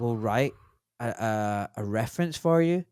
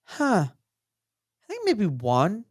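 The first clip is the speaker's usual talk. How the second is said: higher, slow, unsure; hesitant recall; 6 semitones higher, much slower than usual. Retold or imitated. Another doctor trying to recall vaccine lectures, mock slow and unsure. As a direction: drag it out while trying to remember. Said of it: "And they go like".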